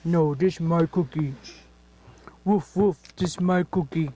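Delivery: low gruff toy dog; doggy voice. Pretend. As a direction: growl it like a grumpy puppy.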